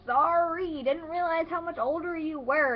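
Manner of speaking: loud, high mock apology; sarcastic apology. Gamer answering an offended ten-year-old, exaggerated sarcasm. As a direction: lay the fake sorry on thick.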